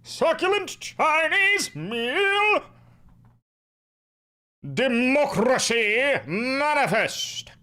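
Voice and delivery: very slow, high, drawn-out declamation; hammed-up, stretched-out stage delivery; theatrical orator. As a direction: savour each syllable grandly. Same speech, drawn out further.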